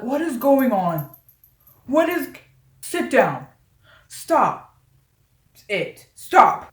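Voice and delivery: loud, retold teacher yelling to stop. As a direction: bark it out like a teacher breaking up a fight. Teachers yelling at fighting students.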